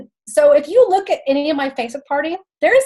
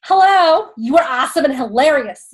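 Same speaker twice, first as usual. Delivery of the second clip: playful mimicry of her accent, louder hello; imitating Alyssa's accent; 4 dB louder than usual. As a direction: put on a friend's accent, big punchy greeting. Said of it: "say it in her accent".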